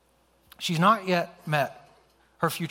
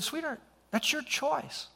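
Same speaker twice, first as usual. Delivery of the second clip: high, slow, tender reply; tender and unhurried, pitched high; gentle father; 11 semitones higher, 4 dB quieter than usual. Retold or imitated. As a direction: softly hand the choice back to a child. Father answers his upset daughter gently.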